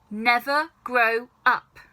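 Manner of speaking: slow, stern order; stern command. Acted. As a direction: lay down the law slowly. Grandma commanding.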